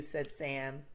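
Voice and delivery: ordinary delivery, nothing marked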